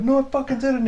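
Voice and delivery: very high mock yell of denial. Beaten guy yelling his denial across the field. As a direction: shout it back indignantly. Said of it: "this guy's yelling out".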